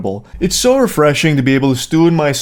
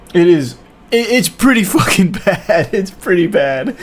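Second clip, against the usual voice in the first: laughing verdict; 4 semitones higher than usual. Real, not acted. Reviewer admits the film is bad, laughing. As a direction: laugh helplessly through the words.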